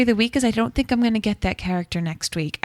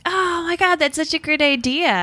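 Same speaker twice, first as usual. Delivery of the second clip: excited; 8 semitones higher than usual. Real, not acted. Delighted at listener's idea.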